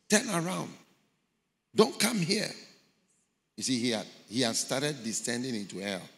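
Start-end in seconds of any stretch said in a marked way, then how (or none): 0.1-2.5 high, pleading warning; urgent warning. Retold or imitated. Voices in hell warning him away. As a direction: warn him off in alarm.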